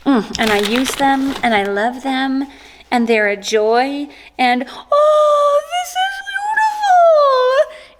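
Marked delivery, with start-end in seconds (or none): 4.7-8.0 very high, drawn-out delighted squeal; louder high gush over something beautiful. Real, not acted. Vlogger opens a beautiful hair thing, delighted squeal.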